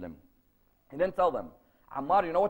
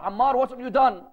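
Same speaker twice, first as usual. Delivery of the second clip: loud, raised-pitch motherly accusation; shocked confrontation; 5 semitones higher, 7 dB louder than usual. Retold or imitated. Mother to Ammar over the broken idol, shocked confrontation. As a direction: confront your child, voice pushed up and loud.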